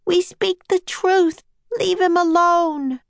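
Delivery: put-on high, slow, insistent girl's voice; young girl Annie. A narrator's reading. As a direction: plead slowly in a high voice. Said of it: "said Annie".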